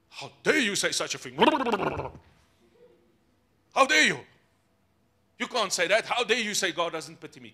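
indignant, hammed-up protest; mock outrage. Preacher playing an offended listener challenging him, mock indignation. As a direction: act affronted, bursting out on the rebuke.

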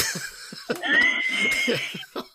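very high squealing laughter; shrieking laugh. Real, not acted. Host shrieking with laughter at the finger-cutting story.

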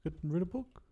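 chill, lazy mock Kiwi accent; mock New Zealander. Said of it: "everyone talks like this".

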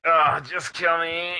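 slow, drawn-out pleading groan; mock despair. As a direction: beg to be put out of misery, laughing. Mock despair at a card game.

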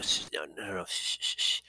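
soft mock shushing; hushing. Aide stopping the president from signing, soft urgent shushing. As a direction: quietly hush him before he does it. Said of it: "it was like".

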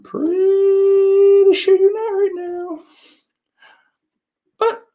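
very high, drawn-out, loud squeal; piercing sing-out tease; mock scolding. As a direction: stretch it out way up high, teasing.

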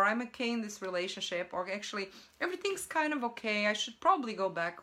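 fast, tumbling mock reassurance; rushed excuses. Voicing someone talking themselves back into old life, rushed excuses. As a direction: hurry through the excuses lightly. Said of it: "you think like".

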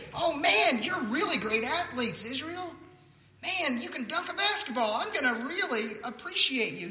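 high-pitched, hammed-up fan gushing; mock starstruck. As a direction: gush like an overawed sports fan, in jest. Acts out God admiring athletic Israel.

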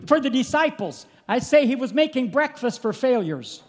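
high, loud revival preaching; preaching at full force. Acted. Preacher to congregation about Jesus praying, preaching at full force. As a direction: preach it out to the church, full throat.

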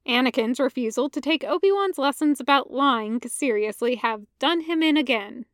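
usual speaking voice

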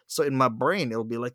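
ordinary delivery

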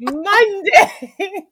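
high, loud cry through laughter; laughing shout. Real, not acted. Podcaster repeating the confession to laughing co-host, laughing shout. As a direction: shout it while cracking up.

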